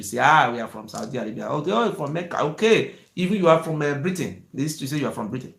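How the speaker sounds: ordinary talk, nothing marked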